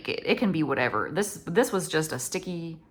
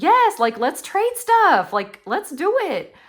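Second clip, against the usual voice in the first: eager inner yes; 10 semitones higher, 6 dB louder, slower than usual. Retold or imitated. Her own eager thought.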